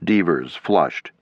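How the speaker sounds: plain reading voice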